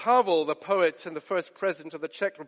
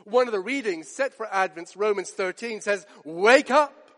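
raised, urgent sermon voice; fervent preaching; 5 semitones higher, 6 dB louder than usual. Acted. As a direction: press the urgency on the congregation. Preaching the end times.

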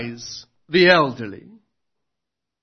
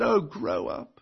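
dismissive scoff; 7 dB quieter, slower than usual. Acted. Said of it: "And Ahab would have said".

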